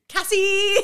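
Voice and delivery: high, drawn-out call; calling out a name. Acted. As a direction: sing out a greeting to someone. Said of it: "I walk around just going".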